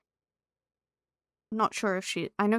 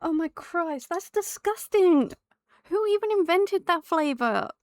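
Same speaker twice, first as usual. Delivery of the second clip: disgusted; 6 semitones higher, slower than usual. Real, not acted. Disgust at the flavor.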